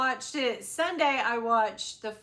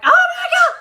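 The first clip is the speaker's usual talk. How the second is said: high, loud, laughing oh my God; laughing scream; 17 dB louder than usual. Retold or imitated. Retelling jumping off the couch, shrieking with laughter. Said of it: "I got up off this couch and was like".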